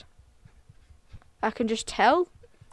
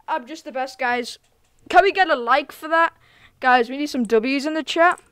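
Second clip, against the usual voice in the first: ordinary talk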